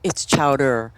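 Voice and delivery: ordinary talk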